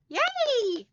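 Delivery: high squealed cheer, jumping way up in pitch; excited yay. Real, not acted. Host cheering a viewer's painting plans, high excited squeal. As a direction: squeal it like a delighted fan.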